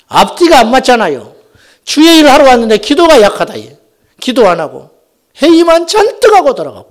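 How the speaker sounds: loud, high bursts of exhortation; forceful preaching. Acted. Pastor scolding church workers who skip prayer, forceful preaching.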